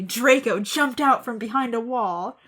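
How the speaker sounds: ordinary delivery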